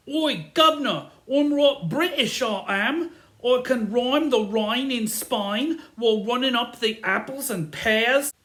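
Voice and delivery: loud, high, exaggerated Cockney British; over-the-top Cockney British accent; hammed-up British accent. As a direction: show off a stagey British accent. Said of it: "I can do a British accent".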